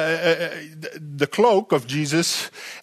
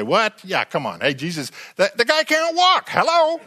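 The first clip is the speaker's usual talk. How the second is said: high, mocking complaint of the crowd; scoffing bystanders, much higher pitched; grumbling crowd; 6 semitones higher than usual. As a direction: whine it like a doubting onlooker. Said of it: "And people start grumbling".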